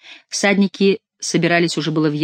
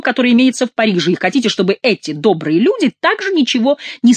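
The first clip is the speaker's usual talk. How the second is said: high, loud mounting harangue; scolding tirade; 6 semitones higher, 4 dB louder than usual. A narrator's reading. Chicot berates the men for shouting death, scolding tirade. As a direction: pile up the reproaches, dropping at the end.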